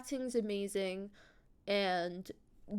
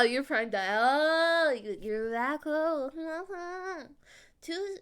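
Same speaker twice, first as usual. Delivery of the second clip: ordinary delivery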